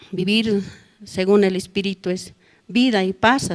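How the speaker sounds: ordinary delivery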